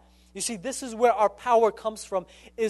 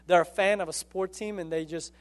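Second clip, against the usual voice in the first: ordinary delivery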